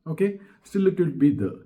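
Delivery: ordinary talk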